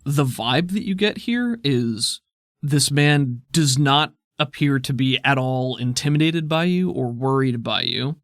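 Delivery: usual speaking voice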